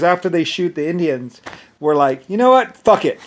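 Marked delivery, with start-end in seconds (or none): 2.3-3.2 higher, louder mock shrug of "fuck it"; reckless shooter. Shooters giving up on caution, mock reckless. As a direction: toss it off like a reckless gunner.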